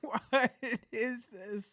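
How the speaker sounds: high-pitched incredulous question; stunned, rising into a high voice; baffled disbelief. Real, not acted. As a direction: ask it high, in astonishment. Baffled by the repeated headline.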